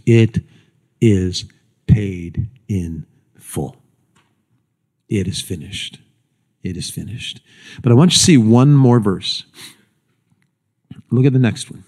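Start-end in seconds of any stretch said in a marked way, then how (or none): 0.1-3.8 very slow, with pauses, sinking lower; grave, measured descent; solemn, word by word. Retold or imitated. Solemnly translates the last cry. 5.1-7.4 low, very soft, fading; quiet, spent-sounding solemnity; hushed final words. Retold or imitated. Jesus' final words, repeated softly.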